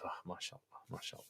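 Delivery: whispered awe, mock admiring; barely-there whispered praise; hushed admiration. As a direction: murmur it very softly like an impressed onlooker. Acted reaction of admiring relatives.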